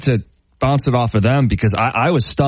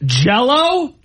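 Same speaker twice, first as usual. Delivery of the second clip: incredulous; 13 semitones higher than usual. Retold or imitated. Incredulous text read aloud.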